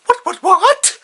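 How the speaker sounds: very high, loud shriek of shock; Sheila. Acted. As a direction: squawk it loudly like an outraged cartoon mom. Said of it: "then Sheila, uh, as always, goes".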